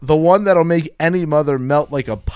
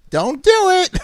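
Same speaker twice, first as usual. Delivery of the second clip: very high, hammed-up warning; 3 dB louder, slower than usual. A narrator's reading. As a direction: warn in a high mock-mother voice. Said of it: "she warned".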